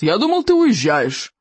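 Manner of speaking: slow, pointed reproach; stern father. A narrator's reading. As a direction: show your son the door, slowly. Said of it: "сурово спросил отец". (суровый упрёк отца)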